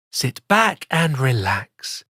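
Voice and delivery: plain reading voice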